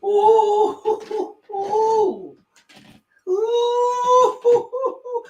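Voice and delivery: high excited oohs, rising; worked-up ooh. Real, not acted. Streamer too hyped by the clip, high excited oohs. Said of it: "Let me calm down".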